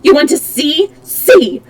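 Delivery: shouting in raw fury; loud. Acted. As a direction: hurl it at her, exposed. Monster unmasked, shouting at her.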